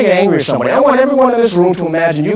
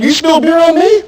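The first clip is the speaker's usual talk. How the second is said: higher, louder mock challenge; mock bar tough; 11 semitones higher, 5 dB louder than usual. Trainer voicing a drunk man in a bar, mock angry. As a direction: pick a fight over spilled beer.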